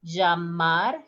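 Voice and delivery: llamar as "jamar"; voiced j pronunciation. Shown as a demonstration. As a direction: start llamar on a buzzed zh. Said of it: "Listen the pronunciation".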